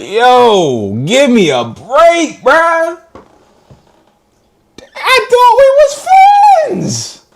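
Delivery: high, slow wounded plea; mock pleading. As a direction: stretch it out like a betrayed friend. Pleading to scammers, voiced.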